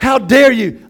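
loud, scandalized how dare you; hammed-up church outrage; mock indignation. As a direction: scold like an offended saint. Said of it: "And we're like".

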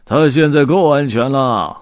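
loud, toothy-grinned character voice; grey-bearded hobo's gleeful jeer; grinning tramp. A narrator's reading. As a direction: gloat loudly through a wide grin. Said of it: "灰胡子龇着牙笑道".

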